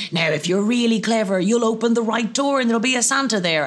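put-on bright children's-entertainer voice; elf talking to children. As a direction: elf coaxing children to pick a door. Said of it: "But you tell the children".

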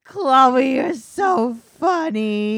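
loud, high, drawn-out gushing; exaggerated mock cooing at a child; baby talk. Imitating her gushing over Chloe, loud baby talk. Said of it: "like, to a baby".

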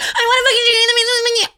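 fast, very high, loud mock whine; whiny tone. Shown as a demonstration. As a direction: demonstrate an unbearable whine at full pitch. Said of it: "biggest pet peeves in the entire world is whiny tone".

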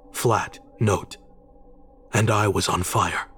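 ordinary delivery, nothing marked

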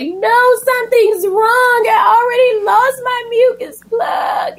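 mock crying shout. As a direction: cry it out high and panicked.

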